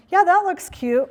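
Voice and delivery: said high and bright, meaning it; honest cheerful praise; bright sincere compliment. Shown as a demonstration. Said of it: "it could be a simple statement like".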